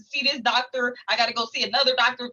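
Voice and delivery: usual speaking voice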